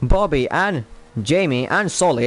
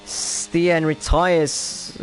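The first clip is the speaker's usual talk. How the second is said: usual speaking voice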